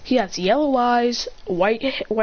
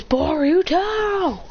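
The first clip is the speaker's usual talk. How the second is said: high, drawn-out name call; acted scolding holler; 8 semitones higher, much slower than usual. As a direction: stretch the name in exasperation. Calls out the culprit.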